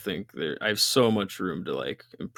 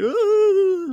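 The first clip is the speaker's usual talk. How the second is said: surprised gasp; 8 dB louder than usual. Retold or imitated. Everyone reacting to the persona.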